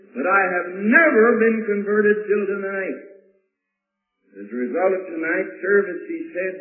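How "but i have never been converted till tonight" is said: slow, higher retold testimony, leaning on NEVER; evangelist-like fervour. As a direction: pour it on like an evangelist. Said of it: "was pouring it on like an evangelist".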